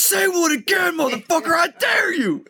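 high, slow shouted threat; imitating Jules, shouting with pitch way up; mock shout. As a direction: yell it like an enraged hitman. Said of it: "he's like".

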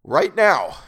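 "Right now" shouted, loud and high; desperate insistence. A narrator's reading. Man willing himself to wake, desperate shout. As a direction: belt out RIGHT NOW to force it. Repeats the order to himself, escalating.

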